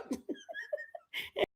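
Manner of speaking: helpless high-pitched laughter trailing on. Real, not acted. Mom cracking up at her own Panera remark, high laugh. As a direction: laugh in a soft, very high squeal.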